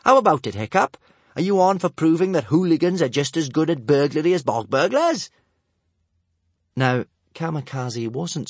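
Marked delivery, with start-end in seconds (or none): 0.0-5.3 higher, louder challenge; girl daring a boy. A narrator's reading. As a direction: dare him on. Girl dares Hiccup.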